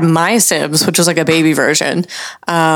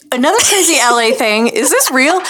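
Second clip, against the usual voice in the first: excited; 13 semitones higher than usual. Real, not acted. Excited high voices about secret highway.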